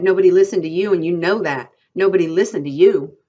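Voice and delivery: loud, forceful dismissal; crying out. A narrator's reading. Curly's wife beats down Candy's threat, crying out. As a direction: hammer it home at high volume. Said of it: "she cried".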